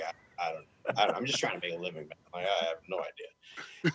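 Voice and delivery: low, very soft, halting; quick, hushed self-quote; sheepish reply. Retold or imitated. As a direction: murmur it, at a loss. Retold sheepish reply to teammate.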